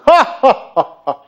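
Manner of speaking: loud, high deliberate laugh; big put-on belly laugh; demonstration laugh. Shown as a demonstration. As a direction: lead a group in forced laughter. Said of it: "Can I, can I demonstrate?".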